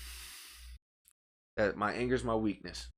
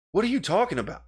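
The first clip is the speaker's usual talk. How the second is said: raised, higher voice of confused protest; louder and incredulous, short of a real yell; a little loud; 6 semitones higher, 5 dB louder than usual. Retold or imitated. Showing how he spoke to his friend, a little loud. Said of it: "that's the extent of loud".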